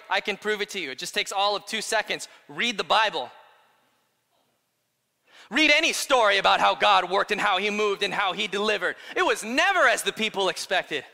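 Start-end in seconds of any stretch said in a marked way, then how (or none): 5.5-11.0 loud, driving sermon delivery; building to a forceful point; emphatic preaching. Real, not acted. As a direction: preach it hard, pushing every phrase.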